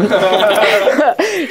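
short amused laugh; giggle at own slip. Real, not acted. Laughing at saying bee pen, light giggle.

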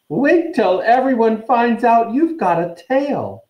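put-on high, slow, gleeful ghost girl. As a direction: relish it like a gloating ghost. Myrtle guffawing, gloating.

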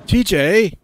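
loud, high call of the name; urgent yell. Acted. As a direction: shout to jolt a friend out of a trance. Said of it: "I yell at him".

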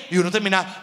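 normal talking voice

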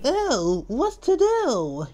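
high, slow fretting voice; fussy alarm. Acted. Mister Fussy hears a crash outside, fussy alarm. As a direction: fret in a high, drawn-out voice.

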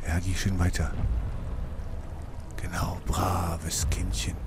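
slow mocking whisper. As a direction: whisper it slowly, teasing the monster. Whispering at monster walking off.

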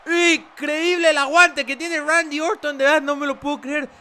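high, loud disbelieving yell; shocked commentator. Acted. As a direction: scream at the kickout.

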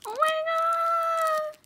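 very high, long-drawn wail of disbelief; overwhelmed, near tears. Real, not acted. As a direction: wail it slowly, truly overwhelmed. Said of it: "I'm gonna cry, guys".

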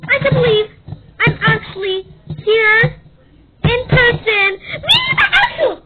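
high, loud, thrilled self-introduction; excited shouting. Acted. Streamer hypes herself to the crowd, high excited shouting. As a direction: shout your own name like a star.

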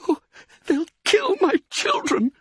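very high, tearful wail; anguished cry. A narrator's reading. Mother fearing for her children, anguished cry. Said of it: "cried Missus Fox".

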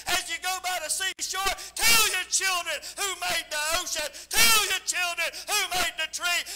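loud, shouted sermon; full-force preaching. Acted. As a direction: preach it out at full volume to the congregation.

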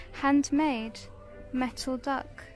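ordinary talk, nothing marked

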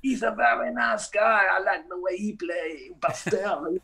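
exaggerated French accent, high pitched; hammed-up French jazzman voice. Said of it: "speak in my French accents".

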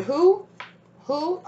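incredulous who. Real, not acted. Mock disbelief at stranger.

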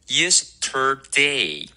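Yesterday slowly, syllable by syllable, R curled; curled-tongue R. Shown as a demonstration. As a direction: draw out Yesterday with a full "day" at the end. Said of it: "有一个卷舌".

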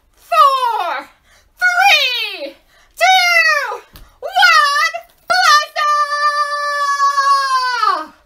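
high, loud, building countdown; launch countdown. Pretend. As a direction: shout it up to a big blast-off. Rocket countdown for children.

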